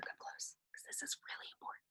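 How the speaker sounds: hushed stage whisper, very soft; confiding whisper. Pretend. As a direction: whisper it like a secret to lean in for. Drawing children in for a secret.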